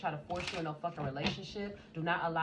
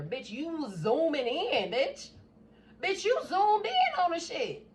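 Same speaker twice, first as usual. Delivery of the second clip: high, loud, slowed disbelief; incredulous call-out; 10 semitones higher, 7 dB louder, slower than usual. Acted. To Libra's nosy lover, loud high incredulous call-out. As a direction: stretch out the accusation in shock.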